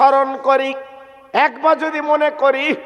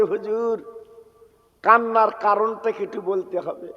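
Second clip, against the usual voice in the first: soft, lower-pitched pleading question; gentle asking; 4 semitones lower, 6 dB quieter than usual. Acted. Wife asking her husband why he weeps, gently asking. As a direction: voice the wife quietly and respectfully. Wife softly asks reason for tears.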